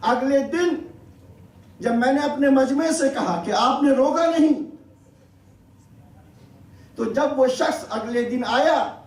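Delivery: ordinary talk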